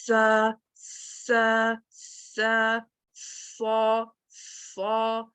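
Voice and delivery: very slow سا with a hissed start; drawn-out sa. Shown as a demonstration. Teacher showing the difference between letters: slow hissed sa. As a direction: stretch each سا after the hiss. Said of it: "یہ دونوں میں فرق ہے".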